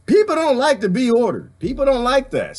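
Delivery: ordinary delivery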